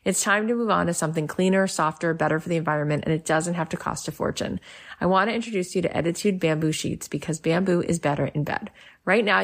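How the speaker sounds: normal talking voice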